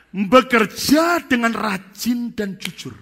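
high, loud, very slow exhortation; preaching emphasis. Real, not acted. Preacher exhorting the congregation, loud slow emphasis. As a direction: drive the point home to the church.

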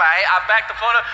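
ordinary delivery

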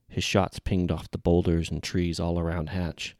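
ordinary delivery

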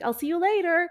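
breezy goodbye. Retold or imitated. Mother slipping out on her kids, breezy.

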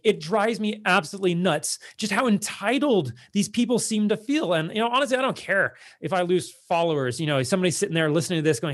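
ordinary talk

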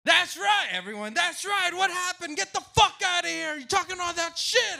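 high, loud gloating; mock the losing fan; gloating shout. Rival fans gloating at him.

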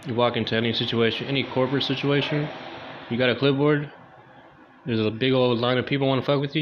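normal talking voice

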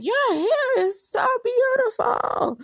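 high, drawn-out gushing; syrupy, stretched-out admiration; imitated gushing compliment. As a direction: gush slowly way up high, mimicking an admirer. Client gushing over her hair.

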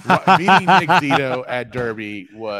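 slow, loud, laughing gush; laughing while talking. Real, not acted. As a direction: laugh through the words, slowly. Gushing about meeting Nick Zito.

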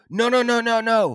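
high, loud run of mock protest; retold frantic pleading. Pleading with the neighbour holding a pit bull, retold frantic. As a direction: act out hurried pleading.

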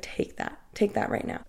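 half-whispered, urgent instruction; retold discreet order. Manager motioning to bartender to grab the drink, hushed order. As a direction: give the order under your breath, imitating him.

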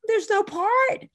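high, slow, plaintive whine; plaintive complaint. Retold or imitated. Actress complaining to the director, high plaintive whine. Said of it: "I said".

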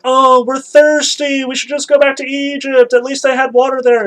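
mocking complainers in a high nasal whine; whining Israelites. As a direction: whine like a hungry grumbling crowd.

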